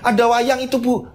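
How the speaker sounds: high-pitched frightened report; panik mengadu. Acted. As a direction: tell mother in a panic, voice high. Sekar panik melapor ke ibu. (mengadu ketakutan dengan nada tinggi)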